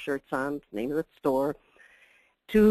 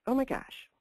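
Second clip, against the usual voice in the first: higher, exclaimed oh my gosh; mock dismay; 7 semitones higher, 5 dB quieter than usual. Shown as a demonstration. Speaker reacting to the next slide in mock shock. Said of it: "that's an O M G".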